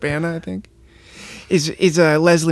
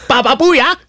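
much higher, louder repeated chant; weird greeting; 14 semitones higher, 6 dB louder than usual. Retold or imitated. Husband pushing his strange hello on George, higher and louder. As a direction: insist on the odd greeting, climbing high. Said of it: "like he's saying hello in some weird Cantonese dialect".

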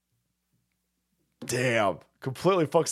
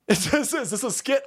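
ordinary delivery

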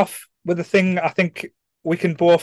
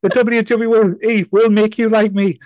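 higher, louder wrestling promo boast; Roman Reigns promo; 7 semitones higher, 5 dB louder than usual. Retold or imitated. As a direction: puff up like a wrestler cutting a promo. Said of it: "like, doing a wrestling promo now".

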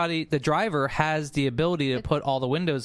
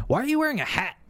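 imitating Chuck; 9 semitones higher, faster than usual. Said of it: "Chuck's like".

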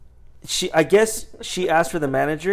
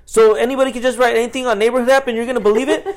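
higher, louder retold incredulity; exasperated retort; 8 semitones higher, 4 dB louder, faster than usual. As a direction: argue back in disbelief. Own retort in retold quarrel.

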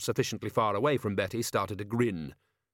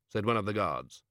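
ordinary delivery

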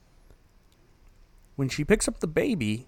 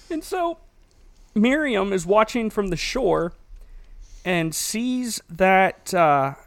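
normal talking voice

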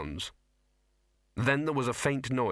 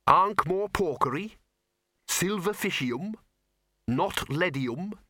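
slow, high musing; suggesting names; 8 semitones higher, slower than usual. Acted. Peavy offers his friend names, slow and teasing.

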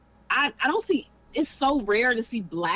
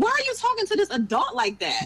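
exasperated; 11 semitones higher than usual. Retold or imitated. Indignant inner reaction to teachers.

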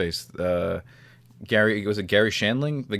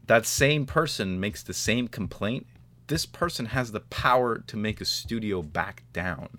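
ordinary talk

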